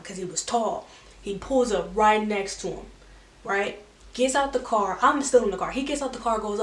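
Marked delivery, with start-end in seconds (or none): none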